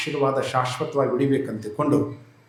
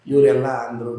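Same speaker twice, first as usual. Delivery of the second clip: usual speaking voice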